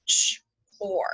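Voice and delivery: "Ch, or" with an airy ch, a gap, then or; sounded out. Shown as a demonstration. Teacher helping pupils find where or falls, sounded out as "Ch, or". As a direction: break "Ch, or" into its two sounds. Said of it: "let's tap that one out".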